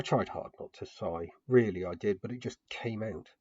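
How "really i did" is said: plain reading voice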